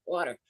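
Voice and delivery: lip-free water; water said without lips; ventriloquist water. Shown as a demonstration. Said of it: "Say water".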